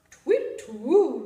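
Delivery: loud hooting owl call. Acted. The owl answering the Gruffalo's child with a loud hoot.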